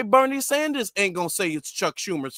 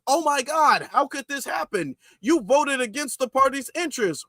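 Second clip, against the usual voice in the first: higher, hammed-up fake dismay; mock shocked politician; 6 semitones higher than usual. Commentator mocking Democrats' pretend shock, higher pitch. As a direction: play the betrayed party member. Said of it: "making it seem as if".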